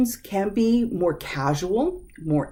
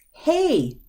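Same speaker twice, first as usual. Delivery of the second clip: high call of hey; attention-grabbing hey; 10 semitones higher than usual. Shown as a demonstration. Teacher greeting someone with a high hey. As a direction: call out to a friend. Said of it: "Grabbing somebody's attention".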